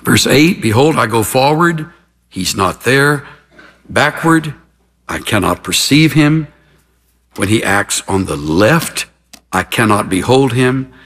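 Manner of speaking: low, measured reading with weighted pauses; solemn scripture reading. Real, not acted. Preacher reading a sufferer's words of faith to listeners, solemnly. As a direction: read it gravely, pausing after each phrase.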